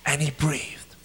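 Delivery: low, soft meditation voice; hushed prayer guide; guided meditation. Real, not acted. Leads guided breathing prayer.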